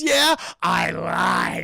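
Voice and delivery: slow, loud drawn-out words; hammed-up villain reveal; gloating villain. Villain's punchline before the throw.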